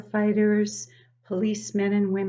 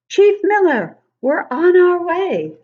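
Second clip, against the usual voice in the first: higher, louder firefighter call; urgent radio call; 10 semitones higher, 13 dB louder than usual. Acted. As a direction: call it into the walkie-talkie urgently. Liz on walkie-talkie in story.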